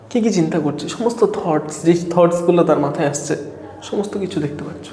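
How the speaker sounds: low, softened storytelling hook; scene-setting hook. Acted. Teacher reveals the topic after the imagined mind-reading, hushed hook. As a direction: let it sink low and quiet, as a reveal.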